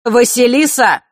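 sudden loud, high call. A narrator's reading. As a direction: summon her sharply by name. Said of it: "вдруг окликнул Нортон-старший".

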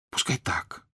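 soft frightened whisper. A narrator's reading. Father hushing Mitya, soft whisper. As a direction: keep it under your breath. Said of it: "зашептал папенька".

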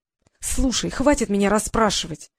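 ordinary delivery

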